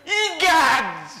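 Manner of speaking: high, loud startled gasp of a word; startled exclamation. Acted. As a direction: jolt back in shock. Old man sees the worm.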